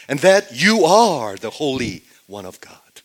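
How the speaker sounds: loud, ringing declaration of faith; emphatic proclamation. Acted. Peter confessing who Jesus is, loudly proclaimed. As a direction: proclaim it forcefully, leaning on the key words.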